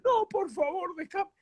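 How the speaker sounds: very high fake-crying plea; mock tearful pleading. Prankster pushing off Almada, mock tearful pleading. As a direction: beg in a high put-on sob. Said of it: "decía yo llorando".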